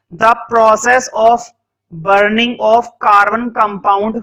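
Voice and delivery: very slow, word by word with long pauses; slow dictation. Shown as a demonstration. Teacher dictating a definition for notes, very slow. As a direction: dictate each word so students can write. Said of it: "तो लिखो combustion में".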